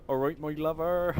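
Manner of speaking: high, loud West Country "All right, my lover"; Cornish accent. Retold or imitated. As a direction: greet like a Cornish farmer. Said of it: "they say stuff like".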